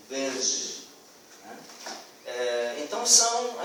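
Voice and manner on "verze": verze said lower and softer; child's version. Shown as a demonstration. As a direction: quieter verze, still with z. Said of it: "tentar colocar esse verze". (verze mais baixo, com z)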